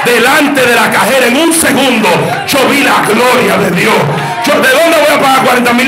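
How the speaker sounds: high, loud, fervent preaching shout; full-force preaching. Acted. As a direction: lift the church with a roaring testimony. (predicación a todo pulmón, alta y gritada)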